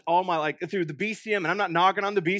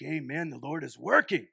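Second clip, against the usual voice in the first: mock congregant amen; 5 semitones lower, 4 dB quieter than usual. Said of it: "You're like".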